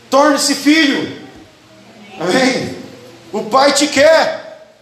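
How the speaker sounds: slow, high, urgent preacher plea; slow fervent plea. Real, not acted. Pastor urging the church to become sons, slow and fervent. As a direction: stretch each word out, pleading with the church. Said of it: "preacher pleading slowly".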